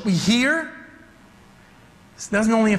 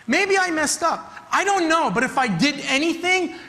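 pleading father; 5 semitones higher than usual. Acted. Father voiced pleading to Jesus.

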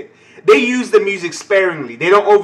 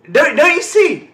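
higher, louder and slower; louder, higher, stretched-out plea; excited outburst; 11 semitones higher, 7 dB louder than usual. Real, not acted. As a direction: wound up, demanding.

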